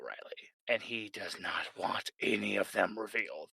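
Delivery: hushed secretive whisper; a conspiratorial narrator whisper. A narrator's reading.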